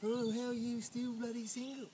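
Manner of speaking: a hint of a sighing admirer; mock swooning ladies. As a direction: lightly play the ladies despairing over him. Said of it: "all you ladies are going".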